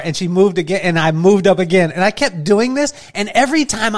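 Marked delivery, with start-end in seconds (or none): none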